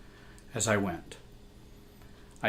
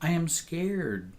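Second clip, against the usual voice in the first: put-on small scared child; 6 semitones higher, 3 dB quieter than usual. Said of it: "I said".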